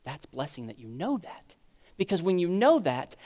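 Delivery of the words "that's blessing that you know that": soft, low, near-whispered reassurance; tender answer. Acted. God answering a broken believer, tender hushed reassurance. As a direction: hush it right down, tenderly reassuring.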